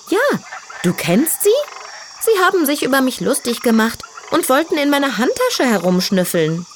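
put-on high, vain young piglet voice; girly piglet voice. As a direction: play a proud little diva pig. Tütü complains.

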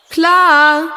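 high, loud reply echoing from far off; distant echoing call; echo from the cave. A narrator's reading. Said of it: "hallte es wie ein Echo zurück".

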